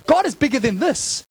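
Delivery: normal talking voice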